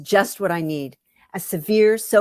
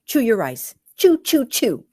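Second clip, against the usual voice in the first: mock mantra; 5 semitones higher, 6 dB louder than usual. Narrator mocking the macrobiotic chewing rule, hammed-up chant. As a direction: chew punched higher and louder, mockingly.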